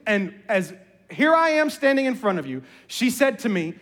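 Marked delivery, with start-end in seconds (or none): none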